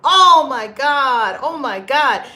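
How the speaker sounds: loud, slow exasperated cry. Real, not acted. Frustrated that viewers won't take action, loud. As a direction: let the frustration burst out.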